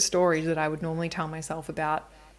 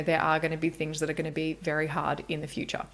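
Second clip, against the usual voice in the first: ordinary talk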